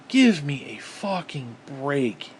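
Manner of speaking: slow, voiced, drawn-out; slow exasperation. Real, not acted. Fed up with hypocrisy, drags it out slowly. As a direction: spell out each word in disgust.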